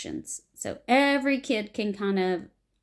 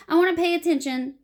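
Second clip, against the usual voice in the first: ordinary talk, nothing marked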